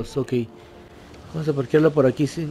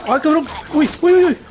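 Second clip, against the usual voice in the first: very high, loud yelps of alarm; real startled squeal; 13 semitones higher, 5 dB louder than usual. Real, not acted. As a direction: yelp as the enemies catch you off guard. Caught off guard in game fight.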